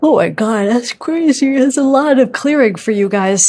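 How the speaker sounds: words stretched through a real yawn. Real, not acted. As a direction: talk right through a big yawn, pitch up. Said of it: "Sorry about the yawning".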